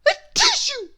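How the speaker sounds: loud, high explosive sneeze; a huge comic sneeze; big sneeze. Acted. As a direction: let the sneeze burst out. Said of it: "a noise that sounds like this".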